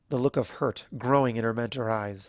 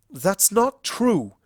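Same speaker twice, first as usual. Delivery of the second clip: high, loud, slow insistence; insisting; 8 semitones higher, 7 dB louder, much slower than usual. A narrator's reading. Celestia denying the charge, insistent and frustrated. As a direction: stamp down the denial. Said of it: "Celestia insisted".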